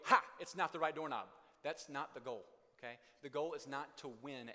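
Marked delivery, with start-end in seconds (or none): 0.1-1.3 loud told-you-so crow; gloating. Pretend. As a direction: rub it in with a loud triumphant "Ha". Spouse gloating over wrong doorknob.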